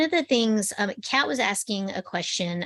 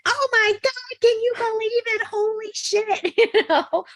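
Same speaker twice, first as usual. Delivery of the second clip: panicked exclaim; 3 dB louder than usual. Retold or imitated. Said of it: "I'm like".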